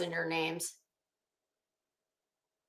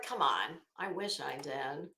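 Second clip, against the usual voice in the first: ordinary talk, nothing marked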